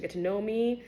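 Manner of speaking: ordinary talk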